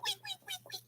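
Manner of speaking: very high, soft squeaky wheeks; guinea pig wheek. Retold or imitated. Imitating the guinea pig begging for food.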